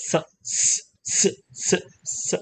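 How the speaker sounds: unvoiced "સ" hissed by itself; separate S sound. Shown as a demonstration. Teacher separates a letter for learners, voiceless "સ". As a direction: repeat "સ" as an isolated consonant. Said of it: "સ અલગ છે".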